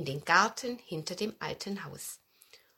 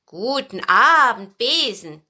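Fuchs; 10 dB louder, slower than usual. A narrator's reading. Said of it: "sagte der Fuchs".

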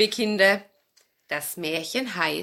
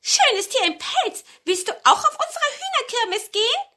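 high, loud, giggling hen voices; excited hens; 5 dB louder than usual. A narrator's reading. Hens invite the fur-coated stranger, high and giggly. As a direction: hens chirping an eager invitation.

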